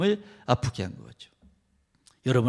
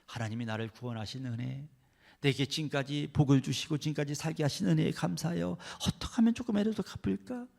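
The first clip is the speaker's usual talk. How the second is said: usual speaking voice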